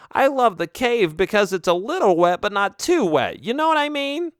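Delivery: high, whiny little bat; squeaky fussy creature. Acted. Bat voiced in story.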